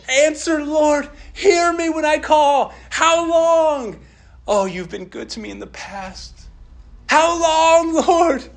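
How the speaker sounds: David's anguished back and forth prayer; pleading, wrestling prayer. Acted. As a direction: cry out to the Lord, aching. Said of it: "this back and forth wrestling and struggle".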